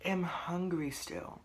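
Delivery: whispered and slow, barely voiced. Real, not acted. Complaining to viewers about still being hungry, whispered.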